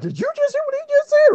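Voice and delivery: mock disbelief. [name] asking Roy in shocked disbelief, high and quick. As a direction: squeak it out in shock.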